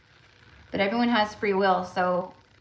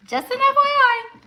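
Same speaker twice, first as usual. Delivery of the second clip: mock sing-song warning; 5 semitones higher, 4 dB louder than usual. Sudden put-on voice for warning.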